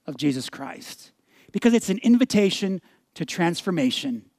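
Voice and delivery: ordinary talk, nothing marked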